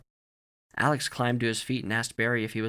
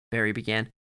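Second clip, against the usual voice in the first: ordinary delivery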